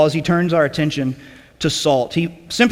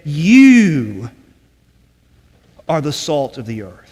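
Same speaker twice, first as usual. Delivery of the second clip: "You" stretched long and loud, then pause; emphatic YOU; 4 dB louder, much slower than usual. Shown as a demonstration. Teacher showing class Jesus stressed "You", emphatic and drawn out. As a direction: lean hard on a drawn-out "You", then drop away after a long pause. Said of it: "this you is emphatic".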